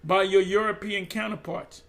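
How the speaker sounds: ordinary delivery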